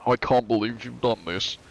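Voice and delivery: mock British accent. Streamer demonstrating British for viewers, British accent. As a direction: try a light British accent. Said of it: "British is like".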